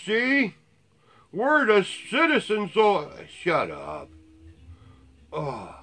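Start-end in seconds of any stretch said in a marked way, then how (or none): 0.0-4.1 much higher and louder, slow and smug; mock militia bragging. Vlogger parodies militiaman boasting about rights, slow smug mock voice. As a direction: lord it over everyone like a wannabe soldier.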